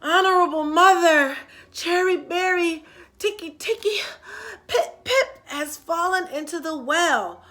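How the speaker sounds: high, loud, tired calling; breathless shout. Acted. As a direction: gasp it out, running out of air. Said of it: "was all out of breath".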